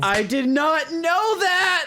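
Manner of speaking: very high, slow exclamation; real astonished outburst. Real, not acted. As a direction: stretch it out in high-pitched disbelief. Amazed reaction to friend's account.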